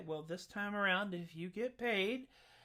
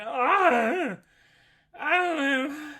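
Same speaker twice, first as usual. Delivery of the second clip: louder, higher, lost groan; dismayed Jack; 8 semitones higher, 12 dB louder, much slower than usual. Acted. Jack dripping butter, dismayed and loud. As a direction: wail it as a bewildered boy.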